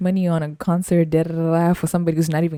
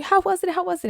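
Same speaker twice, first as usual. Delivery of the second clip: mock eager coworkers; 14 semitones higher than usual.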